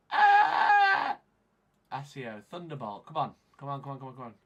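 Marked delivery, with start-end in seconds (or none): 0.1-1.2 piercing high, loud scream; sudden full-voiced high yell; frustrated scream. Real, not acted. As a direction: scream out at the computer. Screams at failing software.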